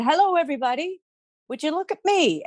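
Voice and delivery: lightly voiced Bridget showing off; Bridget's call. A narrator's reading.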